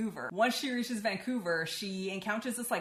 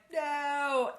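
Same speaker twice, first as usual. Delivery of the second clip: high, loud cry of no; a retold yell of despair, pitched high; anguished no; 9 semitones higher, 4 dB louder than usual. Pestilence protests Sarah being shot.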